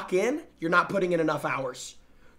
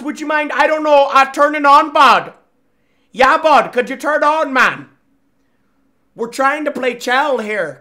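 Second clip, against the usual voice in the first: louder, higher, coaxing the console; loud pleading; 5 semitones higher, 10 dB louder than usual. Real, not acted. Streamer shouting at a buffering Xbox, loud coaxing. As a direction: yell it at the machine, half begging.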